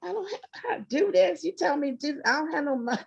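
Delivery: incredulous retort; half-laughing, flustered pushback; retelling her reply to God. Retold or imitated. To God, a mock flustered protest about money. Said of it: "And I said".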